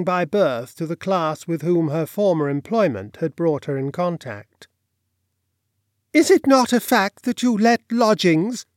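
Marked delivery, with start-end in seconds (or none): 6.1-8.6 higher, louder, slightly shrill odd gentleman; shrill, unbalanced, hesitating. A narrator's reading. Stranger asking landlady about lodgings, shrill and unbalanced. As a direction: make the question shrill and off-balance. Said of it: "there was something shrill, unbalanced, hesitating in his voice".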